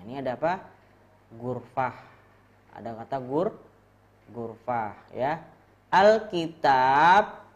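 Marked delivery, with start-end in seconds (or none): 5.9-7.4 Alkitab drawn out slowly and loudly; slow model reading. Shown as a demonstration. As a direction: sound out Alkitab slowly for the class. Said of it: "bacanya gini".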